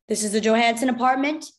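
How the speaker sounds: a soldier's loud question; loud, official query; loud demand. A narrator's reading. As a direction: ask it loudly, with mild force. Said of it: "deep voice asked the question loudly".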